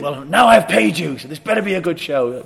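imitating a high, loud, indignant boss; pissed off. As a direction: snap it loudly, put out at having paid. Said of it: "really pissed off with us".

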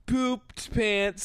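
put-on high, silly little-kid voice; squeaky mock-kiddie potty humour; five-year-old trying to be funny. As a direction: joke like an attention-seeking small child. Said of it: "a five-year-old trying to be funny".

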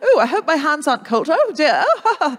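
awkward oohs breaking into nervous laughter; flustered British technician. Acted. British technician clipping on a microphone, flustered.

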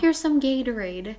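caring mom. Retold or imitated. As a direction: play mum soothing a sick kid. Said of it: "mamma tar hand om en". (härmar omtänksam mamma)

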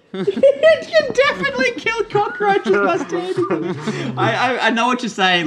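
laughing hard while talking; cracking up mid-sentence. Real, not acted. Laughing at cockroach joke.

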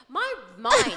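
high embarrassed squeal. Real, not acted. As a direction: squeal it out, flustered.

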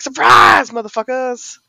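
ambush shout. Acted. Jeb springing the pincer ambush, loud high shout. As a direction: yell it bursting out of the ambush. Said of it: "he's gonna be like".

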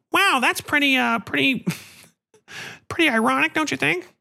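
high, put-on voice for the agent; mock agent voice. Agent Princess Carolyn teasing him on the phone, high mock voice. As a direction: poke fun at him, teasing.

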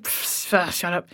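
soft dismissive scoff, nearly breathed; a teen scoffing at a confession, quietly dismissive; scoffing brush-off. Retold or imitated. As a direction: laugh it off under your breath, soft and mocking. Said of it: "just like".

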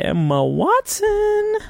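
high, slow, stretched-out name; drawn-out search filler. Real, not acted. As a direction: draw the name out high while typing. Stretches name while googling it.

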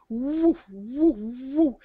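loud mock wailing woo; rising, loud imitated woo; imitated woo sound. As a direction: hoot out the eerie woo noise. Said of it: "Oh my goodness".

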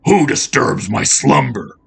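menacing gravelly challenge; gravelly beast. A narrator's reading. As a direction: growl it as a creature woken from sleep. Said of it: "said a gravelly voice".

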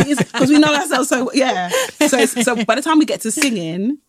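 usual speaking voice

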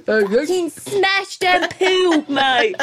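put-on slow, drawn-out laddish accent, laughing; mock northern lad accent. Podcast host mocks a laddish weekend, slow lad accent. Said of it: "It's a great accent".

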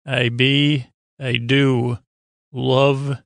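ordinary talk

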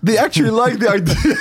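high-pitched, amused amazement; laughing disbelief. Real, not acted. Reacting to friends actually liking the dream idea, laughing incredulously. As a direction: say it through real laughter.

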